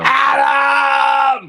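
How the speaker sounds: loud, high drawn-out yell of a name; mock holler. Co-host imitates wrestler yelling on FaceTime, mock holler. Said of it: "This bitch just hollers everybody".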